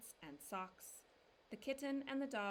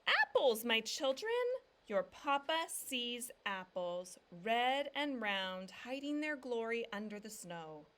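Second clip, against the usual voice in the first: joyful announcement; 3 semitones higher, 9 dB louder than usual. A narrator's reading. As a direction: proclaim it like treasure found. Papa calls from the tree.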